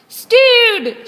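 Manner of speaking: very high, loud yell; rowdy pirate yelling his recipe; pirate shout. Acted. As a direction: blast it out over the deck. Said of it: "shouted one pirate".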